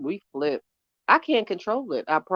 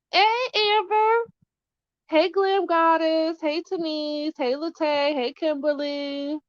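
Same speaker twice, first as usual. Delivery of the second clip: high, drawn-out hellos to each viewer; stretched singsong hey to every name; sing-song greetings; 11 semitones higher, slower than usual. Real, not acted. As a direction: call out each name brightly, slowly.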